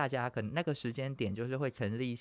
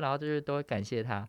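ordinary talk, nothing marked

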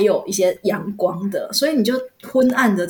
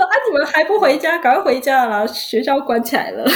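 casual teacher act; 6 semitones higher than usual. Retold or imitated. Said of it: "还演了一出戏哦".

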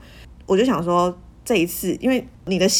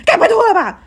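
much higher, much louder mock alarm; panicked mom; 11 semitones higher, 14 dB louder than usual. Mom panicking that the bra came off, loud high mock alarm. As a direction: shriek it like a frantic mother.

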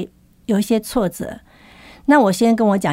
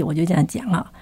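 ordinary talk, nothing marked

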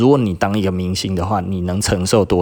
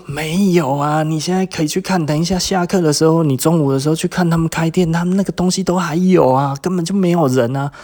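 pitched up high, pleading to be believed; raised, exasperated denial; exasperated high protest; 9 semitones higher than usual. Retold or imitated. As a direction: insist it higher and higher, fed up. Protesting to a teasing classmate.

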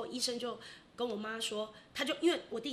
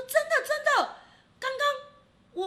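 much higher and louder, urgent insisting; insistent dad; 9 dB louder, slower than usual. Retold or imitated. Dad insisting to disbelieving mom that it really happened, urgent. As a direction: swear it's true, voice jumping up high.

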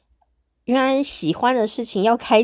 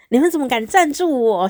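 louder, higher, amazed disbelief; 5 semitones higher, 5 dB louder than usual. Real, not acted. Host marvels that fans dare to sponsor her, amazed and louder. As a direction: marvel aloud at how bold they are. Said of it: "你们怎么敢留言给我啊".

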